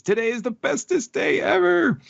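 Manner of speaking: put-on very high squeaky little-girl voice; delighted child. Acting out delighted child Otama.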